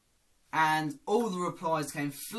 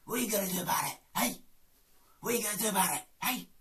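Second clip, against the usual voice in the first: high hey with a light threat; lightly voiced East End tough; Phil Mitchell impression; 6 semitones higher, much faster than usual.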